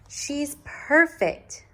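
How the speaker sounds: high, slow, adoring mother voice; mother's line. A narrator's reading. Mother gazing at her newborn, high and slow. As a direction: breathe it out with delight. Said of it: "said her mother".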